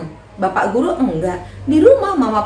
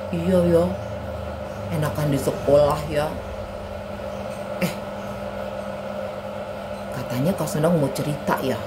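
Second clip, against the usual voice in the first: very low, monotone character voice; glum puppet boy Anto; 5 semitones lower, 6 dB quieter than usual. Acted. Anto tells Ani about being scolded, glumly. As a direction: drone the complaints out low.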